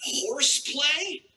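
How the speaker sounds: slow, rising disbelief; incredulous echo. Acted. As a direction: repeat it as a stunned question. Comedian disbelieving the quoted excuse.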